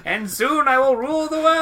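high, loud villain boast; megalomaniac rant. Pretend. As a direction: gloat like a cartoon supervillain. Supervillain boast.

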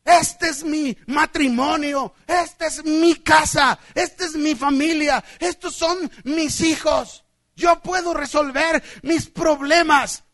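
defiant mock boast, slowing phrase by phrase; self-sufficient believer. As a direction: act out stubborn self-reliance.

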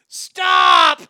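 full-force, high-pitched shout; yelling. Acted. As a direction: yell it like all caps. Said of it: "And he'll yell".

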